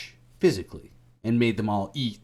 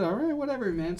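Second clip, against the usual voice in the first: much higher, careless brush-off; squeaky dismissive apostle; 12 semitones higher than usual. Acted. Apostles brushing off Jesus, squeaky and dismissive. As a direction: toss it off in a high squeak.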